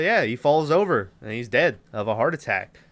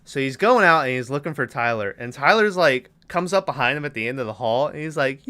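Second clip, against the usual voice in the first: ordinary talk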